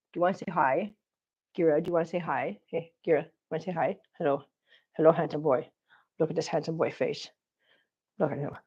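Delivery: quiet cooing to a pet. Real, not acted. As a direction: coo softly at the cat. Cooing softly to the cat.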